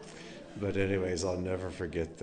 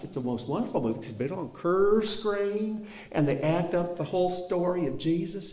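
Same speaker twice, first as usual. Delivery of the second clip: put-on Aunt Royce Tennessee accent; 10 semitones higher than usual. Nephew imitating his aunt inviting them, eager Tennessee accent. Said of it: "the strongest Tennessee accent".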